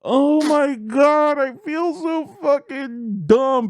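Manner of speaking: high, slow, drawn-out groan; mortified wail. Retold or imitated. Comic looking back at his blunder, mortified. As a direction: moan it in comic shame.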